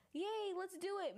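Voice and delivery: bright, peppy mock cheer; imitating an eager mood; excited chirp.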